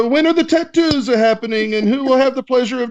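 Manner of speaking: hammed-up Italian accent, like a pizza-shop owner. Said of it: "I'll read it in an Italian accent for you".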